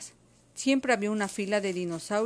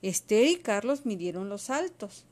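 plain reading voice

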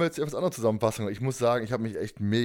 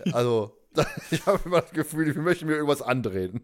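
laughing; 3 semitones higher than usual. Real, not acted.

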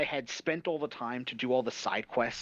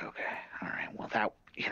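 hushed, breathless whisper of shock; a retold, whispered okay after a shock; stunned whisper; 4 semitones lower, 6 dB quieter, faster than usual. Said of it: "and I'm like".

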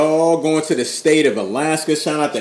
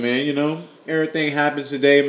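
ordinary delivery

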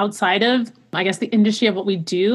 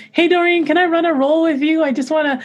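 high, eager little ask; higher-pitched imitation of asking nicely; pleading request; 7 semitones higher than usual. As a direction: ask a favour in a raised voice. Acts out asking coach a favour.

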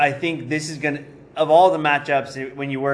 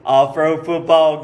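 dumb jock voice; 3 semitones higher, 6 dB louder than usual. Retold or imitated.

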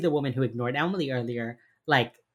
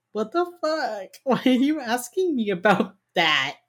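high, startled indignant cry; shocked outrage; 8 semitones higher than usual. Retold or imitated. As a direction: recoil as if the question stung. Wife shocked by detectives' question.

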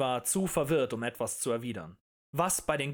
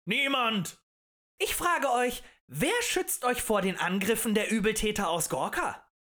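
high, loud orator's pitch; impassioned tavern agitator; rabble-rousing harangue; 8 semitones higher, 4 dB louder than usual. A narrator's reading. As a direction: stir up a crowd with indignation. Agitator questions the crowd.

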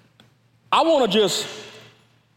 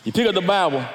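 ordinary talk, nothing marked

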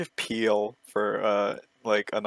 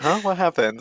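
mock innocence; 8 semitones higher, 5 dB louder than usual. Acting oblivious reply to annoyed teammates.

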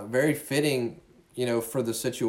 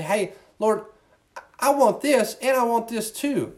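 higher, needy plea to the Lord; pleading with God; 8 semitones higher than usual. Retold or imitated. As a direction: mimic a person bargaining with God. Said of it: "We say".

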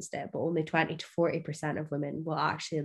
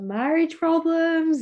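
pointed emphasis; 11 semitones higher, 6 dB louder, slower than usual. Real, not acted. Pointed aside about marriage.

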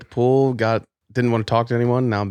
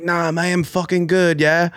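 higher-pitched mock British bloke; British accent, pitched up for the retelling; 8 semitones higher than usual. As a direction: imitate him in a put-on British accent. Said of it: "I just did British".

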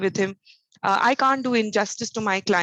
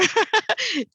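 high laugh; 11 semitones higher than usual. Real, not acted. Laughs at yelling remark.

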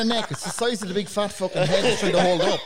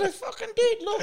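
mock indignant protest. Retold protest to the anaesthetist.

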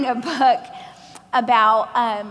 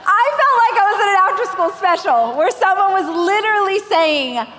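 loud, higher, laughing through it; giddy laugh-talk; 7 semitones higher, 5 dB louder than usual. Real, not acted. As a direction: still cracking up.

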